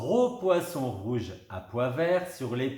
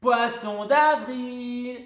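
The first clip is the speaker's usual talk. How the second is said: loud high, slow, drawn-out cry; crowing at the prank; triumphant shout; 11 semitones higher, 6 dB louder, much slower than usual. A narrator's reading. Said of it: "crient triomphalement les deux fillettes". (cri triomphal, lent et aigu)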